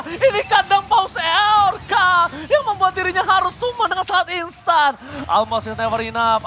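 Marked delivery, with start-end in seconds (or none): 0.0-5.0 excited. Real, not acted. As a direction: stretch the name out with hype. Caster drawls a hype line.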